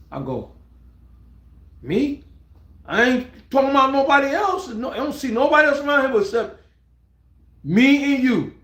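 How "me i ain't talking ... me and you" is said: high-pitched, bewildered protest; acted disbelief. Storyteller answering Pac's summons on the set, bewildered. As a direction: act taken aback, voice pitched well up.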